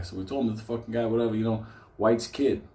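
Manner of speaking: usual speaking voice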